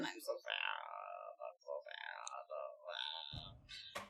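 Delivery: hammed-up hushed lament; mock sad whisper. Gamer mock-mourns a dead end in a hammed-up whisper. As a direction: sigh out the sorrow in a mock whisper.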